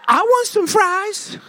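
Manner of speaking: put-on high, slow, sing-song little boy; sweet toddler voice. Father imitating his toddler son asking for fries, high and slow. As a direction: coax like a toddler who wants fries. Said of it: "And he switches his tone".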